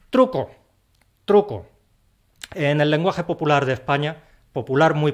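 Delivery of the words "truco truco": announcing a trick. Acted.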